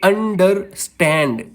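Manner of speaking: Understand slowly in syllables, weight on stand; stress on last syllable. Shown as a demonstration. Teacher giving an anapest example, slow stress on stand in understand. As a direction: split understand, landing on stand. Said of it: "मैं इसको कैसे बोल रहा हूँ".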